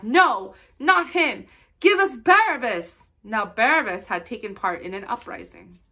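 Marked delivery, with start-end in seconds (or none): 0.0-3.1 loud, high crowd cry, slowing on the name; shouting back. Acted. The crowd shouts back at Pilate, loud and high. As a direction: shout the demand for Barabbas. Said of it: "They shouted back".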